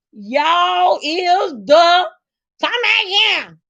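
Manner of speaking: high, drawn-out country call; talking country. Retold or imitated. Husband talking like his wife before he passed, country. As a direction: imitate him hollering country-style. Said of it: "he was talking like that".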